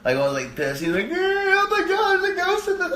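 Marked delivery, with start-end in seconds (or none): 1.1-2.9 very high, loud panicked wail; hammed-up terrified kid shriek; freaking out. Scared kid seeing the hitchhiking ghost, freaking out. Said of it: "the kid freaks the fuck out".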